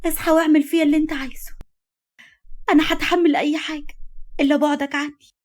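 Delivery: high, anguished plea through tears; tearful pleading. A narrator's reading. Woman at her unconscious lover's bedside, tearfully pleading. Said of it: "وهمست له من بين دموعها".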